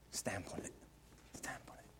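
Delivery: low intense whisper; hushed command. Acted. Preacher urging the church to crush sin, whispered. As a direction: drop to a whisper, stamp it out.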